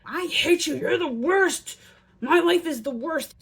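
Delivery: put-on high, loud whiny kid outburst; mock child tantrum. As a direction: play the sulky kid yelling at mom. Acting out an angry child.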